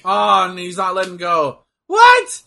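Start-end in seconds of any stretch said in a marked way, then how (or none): 1.9-2.4 high, loud yelp of disbelief; shocked shout. Real, not acted. Fan sees the ref not letting go, shocked shout. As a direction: cry out in disbelief. Outraged at the match.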